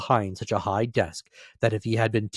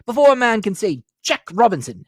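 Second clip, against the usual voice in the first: put-on a hearty old master calling his lads; fat jovial voice; 13 semitones higher, 10 dB louder than usual. Fezziwig calls his apprentices, jovial hearty call. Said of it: "cried old Fezziwig".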